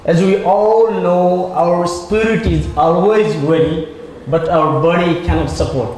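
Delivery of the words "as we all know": ordinary talk, nothing marked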